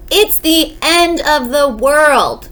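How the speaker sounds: high, slow wail of alarm; panicked shout. A narrator's reading. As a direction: draw out the alarm as the wife. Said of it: "shouted Missus Watson".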